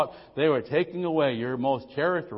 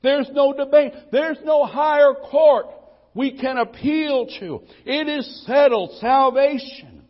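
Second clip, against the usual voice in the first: louder, higher, slowing on salvation; rising full-force preaching; 10 semitones higher, 9 dB louder, slower than usual. Acted. Preacher telling the church the matter is settled, loud and insistent. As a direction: drive each "no" home like a verdict.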